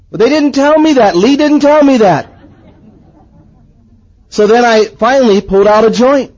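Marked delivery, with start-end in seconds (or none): 0.1-2.3 high, mildly indignant complaint; acted protest. New convert objecting to the drink ban, mock dismay. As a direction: plead ignorance in mock dismay.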